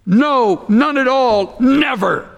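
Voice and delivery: "No, none at all, never" punched high, each phrase set apart; emphatic negation. Shown as a demonstration. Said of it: "way out of place and emphatic".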